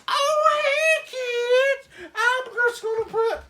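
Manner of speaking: chirpy, hollow cheer of a tired mom; Dee Dee the mom. Retold or imitated. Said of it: "and she's like".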